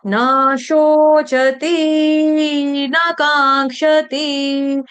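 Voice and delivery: "न शोचति न कांक्षति" sung very slowly, न stretched to naa and ति to tee; wrong tuneful drawl. Shown as a demonstration. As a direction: sing "न शोचति न कांक्षति" with every vowel held long. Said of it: "कितनी अच्छी धुन है". ("न शोचति न कांक्षति" को धुन में हर मात्रा लंबी खींचकर)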